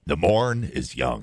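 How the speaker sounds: put-on slow, low old pirate voice. Shown as a demonstration. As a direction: drag it out like an old sea pirate. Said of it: "Sound like an old pirate there".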